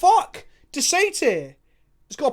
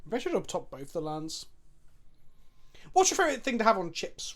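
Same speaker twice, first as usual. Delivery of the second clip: ordinary talk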